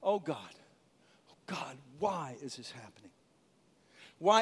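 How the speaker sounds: whispered, anguished plea; David's grieving whisper to God; grieving prayer. Retold or imitated. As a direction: whisper it through grief. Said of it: "he started with grief".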